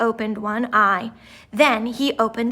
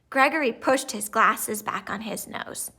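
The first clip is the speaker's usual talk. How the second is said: plain reading voice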